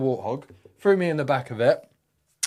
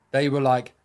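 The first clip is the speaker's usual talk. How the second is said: normal talking voice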